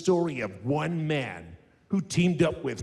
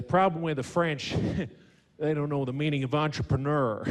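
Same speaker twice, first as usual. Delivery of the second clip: Texan presidential impression; comic Southern politician voice; George W. Bush. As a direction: imitate a folksy American president. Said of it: "when George W would say".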